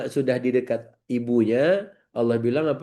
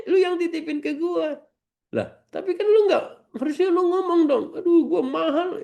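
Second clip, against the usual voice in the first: mock outraged neighbour. The neighbour back from umrah complains about the missing chocolate. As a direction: play the neighbour who lost the chocolate. Acted quarrel continues.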